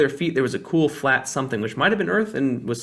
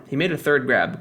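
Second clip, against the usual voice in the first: ordinary delivery, nothing marked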